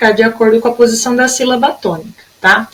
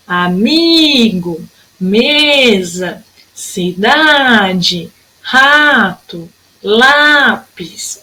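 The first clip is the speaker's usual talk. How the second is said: "amigo, mesa, cidade, rato, lápis", each with a long stressed vowel and short ending; stressed penultimate syllables; much slower than usual. Shown as a demonstration. Teacher listing words, drawn-out penultimate in "amigo, mesa, cidade, rato, lápis". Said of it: "sílaba tônica é sempre a penúltima".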